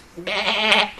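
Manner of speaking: short, high goat bleat; quick imitated "baa". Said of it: "of a goat that you have ever heard".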